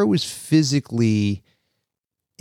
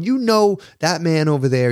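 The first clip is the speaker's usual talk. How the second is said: imitating an agitated local, pitched high; mock the riled-up guy pointing across the table; belligerent stranger; 6 semitones higher than usual. Drunk stranger accusing father-in-law, higher agitated mock voice.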